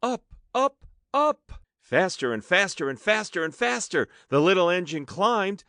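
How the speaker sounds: excited narration, rising; rising excitement. A narrator's reading. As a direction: build up as the engine climbs.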